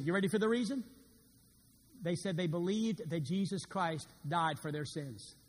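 ordinary talk

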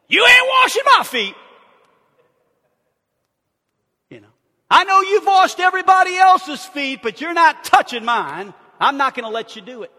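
loud, high, indignant outburst; Peter blurting. Retold or imitated. Peter shouting his refusal at Jesus, indignant. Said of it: "Peter just blurts out".

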